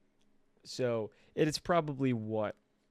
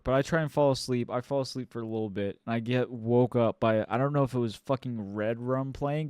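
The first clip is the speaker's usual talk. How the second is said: usual speaking voice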